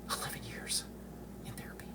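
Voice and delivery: breathy whisper, almost gone. Real, not acted. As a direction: let it fade to a whisper. Repeats the number, drops to whisper.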